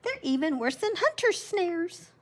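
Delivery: high, panicked hare voice; grandma reading the hare warning Joseph about foxes; shrieking hare. A narrator's reading. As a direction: squeal the warning like a scared little hare. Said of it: "The hare shrieked".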